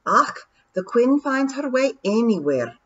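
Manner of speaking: gruff pixie in thick Scots; broad scottish accent. Acted. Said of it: "said Rob".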